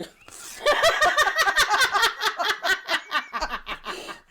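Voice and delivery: long high cackling laugh; loud laugh. Real, not acted. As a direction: howl with laughter. Shared hilarity.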